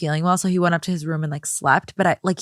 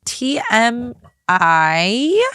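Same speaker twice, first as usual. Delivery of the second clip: drawn-out, higher-pitched TMI; grossed-out protest; 4 semitones higher, much slower than usual. Real, not acted. Co-host reacting to too much hygiene detail, drawn-out protest. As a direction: stretch out each letter in protest.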